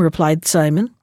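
ordinary delivery

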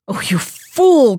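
slow, scornful "Oh, you fool!"; scolding cry. A narrator's reading. Crow rebukes the foolish magpie, slow scolding cry. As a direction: drag it out in exasperated scorn. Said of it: "cried the crow".

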